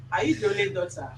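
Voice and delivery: ordinary talk, nothing marked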